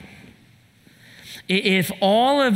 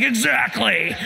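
raised-pitch mock outburst; retold yell at kids; 5 semitones higher than usual. Dad answering his kids back in the retelling, mock yell. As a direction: snap back as the exasperated dad. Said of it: "I just yelled at the kids".

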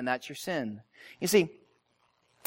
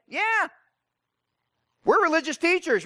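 mock frustrated religious teachers, pitched high; high-voiced grumble of put-out clerics; 8 semitones higher than usual. Religious teachers objecting to Jesus, frustrated. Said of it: "They're frustrated 'cause they're like".

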